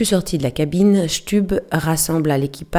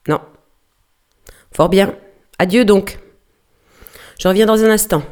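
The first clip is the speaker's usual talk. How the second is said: shouting across; 4 semitones higher, 5 dB louder than usual. Acted. Shouted exchange across ships.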